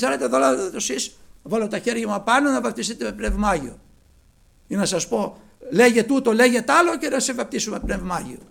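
high, loud, pushy summons; mock modern preacher. Preacher mocking today's showy ministers, hammed-up loud summons. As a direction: mimic a showy preacher's sales pitch. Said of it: "Θα λέγε κανείς".

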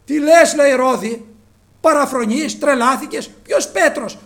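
loud, high disbelief; incredulous scolding. Acted. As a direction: scold her as if she's gone mad. Brothers scolding Rhoda in disbelief. (δύσπιστη επίπληξη, δυνατά)

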